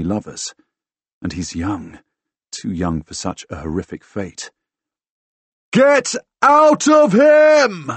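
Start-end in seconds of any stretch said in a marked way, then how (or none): 5.7-7.9 slow, drawn-out, high bellow. A narrator's reading. Fighter orders the ghost out of a friend, bellowing. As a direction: bellow each word as a fierce command. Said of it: "I bellow".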